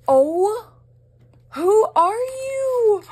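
very high, loud, drawn-out squeal; amazed squeal. Real, not acted. Vlogger to an anonymous gift sender, an amazed squeal. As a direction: squeal it in real astonishment, stretching the words.

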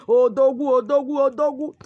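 loud repeated call of Odogwu, rising each time; hailing shout. Acted. Client hails the native doctor.

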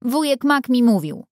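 put-on high, insistent little girl; girl defending the fairies against the boy; girl protesting hotly. A narrator's reading. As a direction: snap back at the boy in a girl's high voice. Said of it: "gwałtownie zaprotestowała dziewczynka".

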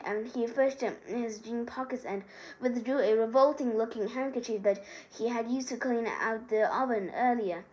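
ordinary delivery, nothing marked

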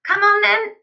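very high, loud call; excited cry. A narrator's reading. Father rushing his boy upstairs, crying out. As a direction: summon him with great gusto. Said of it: "cried Mister Killycranky".

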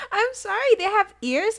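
high, loud apologetic protest; loud laughing defence. Real, not acted. Streamer defending her gorilla-bear mix-up, loud and high. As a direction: defend yourself loudly through laughter.

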